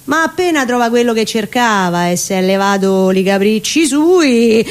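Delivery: ordinary delivery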